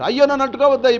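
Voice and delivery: desperate father yelling; frantic warning. Acted. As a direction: shout to stop the children. King warns children away.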